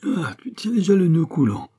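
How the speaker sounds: high, loud outraged cry; acted exasperation; exasperated. A narrator's reading. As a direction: accuse him in rising outrage. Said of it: "l'exaspération de l'abbé".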